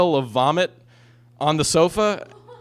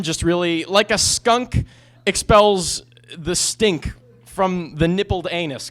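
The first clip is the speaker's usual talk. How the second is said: usual speaking voice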